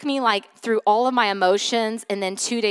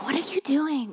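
higher-pitched scolding, held-in volume; retold anger; 5 semitones higher, 4 dB quieter than usual. Mom reenacting scolding her screaming kid, mad but restrained. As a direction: imitate a tense, restrained telling-off. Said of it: "then I get mad".

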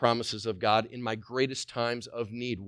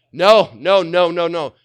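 loud, raised-pitch scolding refusal; emphatic objection; 6 semitones higher, 13 dB louder than usual. Acted. Preacher showing neighbours how to object, loud and emphatic. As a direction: refuse loudly, hitting every word.

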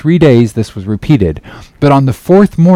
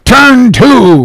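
high, forceful roared order; captain's roar; 11 semitones higher, 4 dB louder than usual. A narrator's reading. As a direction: roar the command at the men. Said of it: "roared the captain".

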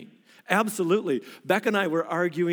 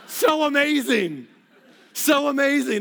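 high, loud, laughing delight; high giggling brag; laughing gloat; 7 semitones higher, 4 dB louder than usual. Real, not acted. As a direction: savour the win with a laugh. Laughing gloat over his feat.

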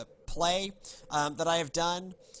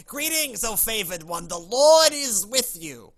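shouting street person; 6 semitones higher, 9 dB louder than usual. Acted. Homeless Gabriel shouting at Mary on the street, New York accent. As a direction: holler it at a passer-by as a New Yorker. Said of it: "homeless person on the street who just starts shouting".

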